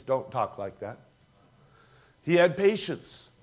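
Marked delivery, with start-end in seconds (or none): 0.0-1.0 low, quiet, stern reply; a low hushed reprimand; low firm rebuke. Acted. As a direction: shut down the doubt gently but firmly. Noah rebukes wife.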